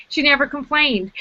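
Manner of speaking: ordinary delivery, nothing marked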